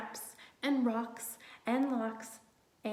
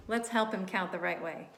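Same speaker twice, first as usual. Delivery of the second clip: usual speaking voice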